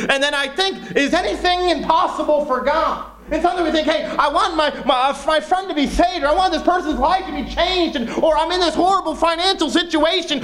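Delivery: high-pitched, impassioned sermon delivery; fervent, insistent preacher; fervent preaching. Real, not acted. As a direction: drive the point home, pitched high and urgent.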